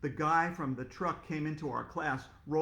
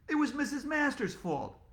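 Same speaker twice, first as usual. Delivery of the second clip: high protesting blame; 10 semitones higher than usual. Acted. Kid blames teacher.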